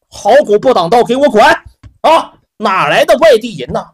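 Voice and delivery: loud, high contemptuous yell; angry insult. Acted. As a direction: snarl at the outsiders to get lost. Manager curses the strangers.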